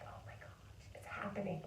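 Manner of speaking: breathless whispered disbelief; awed whisper. Retold or imitated. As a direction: whisper it like you can't believe it's real. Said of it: "you just feel like".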